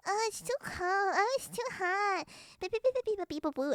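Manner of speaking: high, put-on grumble about the weather; mock complaining. Californian mimicking themselves whining in other places, mock complaint. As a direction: imitate fussy complainers in a high little voice. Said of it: "we're like".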